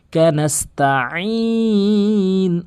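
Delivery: "কানাস্তাইন" slow, squeezed ain and very long final vowel; pressed ain. Shown as a demonstration. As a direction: recite "কানাস্তাইন", pressing the throat before the long ending. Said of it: "নাস্তাইন বলবেন". (আইন দিয়ে টেনে "কানাস্তাইন")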